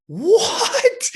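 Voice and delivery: high, shocked yelp of a question; mom's shocked what. Retold or imitated. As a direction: fling it up high in disbelief. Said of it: "she's like".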